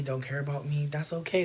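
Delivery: usual speaking voice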